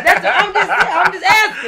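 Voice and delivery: high, laughing, defensive; laughing defense of a cheeky question; laughing defensively. Real, not acted. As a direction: laugh it off, just asking. Defensive laughing after provocative question.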